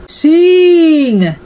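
a giant orders his harp to sing; giant's command. A narrator's reading. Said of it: "said the giant".

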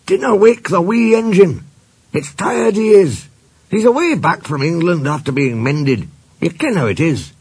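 high, insistent Scots voice; Scottish accent. A narrator's reading. Engine tells others about the tired engine, Scottish accent. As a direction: plead in a high Scottish burr.

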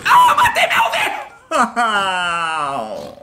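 laughing shriek. Real, not acted. Laughing shock at own mistake. (grito rindo)

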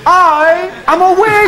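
high, loud mock shriek; screaming wig. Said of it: "it was screaming".

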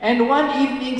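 ordinary talk, nothing marked

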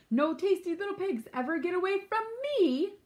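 high, loud wolf outrage; blustering villain yell; howled threat. A narrator's reading. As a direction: rise to an indignant howl. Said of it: "he howled".

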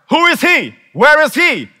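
high, loud outraged shout; shouted royal demand; king's furious demand. Acted. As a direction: bellow it like the angry king. King's demand repeated as acrostic.

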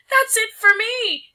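very high, loud slow cry. Acted. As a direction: wail it in terror. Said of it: "his wife cried".

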